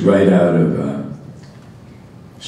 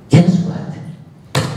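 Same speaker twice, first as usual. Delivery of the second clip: put-on child voice; 11 semitones higher than usual.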